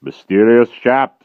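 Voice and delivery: loud, slow, heavy giant voice; dim brutal giant. A narrator's reading. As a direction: drag each word out like a lumbering brute. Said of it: "said the giant".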